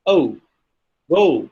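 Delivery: "O" and "go" gliding towards "oo"; diphthong. Shown as a demonstration. Lecturer repeating the example, "O" and "go" as diphthongs. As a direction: glide the vowel in "O" and "go". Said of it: "It's basically O".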